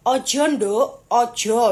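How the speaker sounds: a slow hint of a grandfather's warning; slow, lightly imitated old Javanese man; lightly voiced old man. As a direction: lightly imitate an elder, drawn out. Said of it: "dia bilangnya kayak gini".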